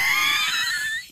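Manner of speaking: high squealing laugh. Real, not acted. Bursts out laughing at threesome idea.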